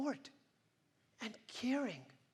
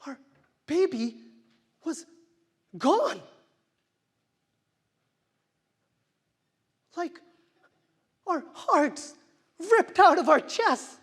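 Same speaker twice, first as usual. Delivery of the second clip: anguished projection; 9 semitones higher, 11 dB louder than usual. Acted. Grief over lost baby.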